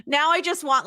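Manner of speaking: ordinary talk, nothing marked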